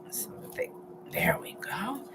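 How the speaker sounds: whispered under her breath; breathy whisper to herself. Real, not acted. Muttering while cutting the florets.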